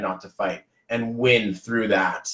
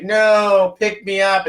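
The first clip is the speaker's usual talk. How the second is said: put-on louder, higher little kid whine; imitating son; 6 semitones higher, 5 dB louder, much slower than usual. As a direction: play the toddler wanting up. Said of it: "And he's like".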